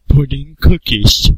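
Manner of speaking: high, slow, goofy put-on voice; Jordan Taylor voice. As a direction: drag it out in a silly childish voice. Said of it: "Where he's talking like".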